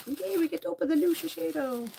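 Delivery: high-pitched happy "yay"; gleeful cheer. Real, not acted. Cheering at opening new product.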